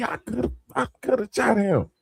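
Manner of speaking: high mock voice of the officer; imitating the cop. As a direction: gasp it like a shaken officer. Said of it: "That's why I ain't say".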